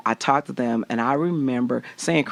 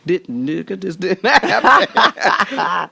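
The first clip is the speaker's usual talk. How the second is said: slow, louder laughing denial; laughing hush-up; 4 semitones higher, 6 dB louder, much slower than usual. Retold or imitated. To the security guards, slow loud laughing denial. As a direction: drag it out laughing.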